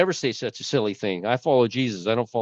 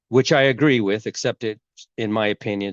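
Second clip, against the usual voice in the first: normal talking voice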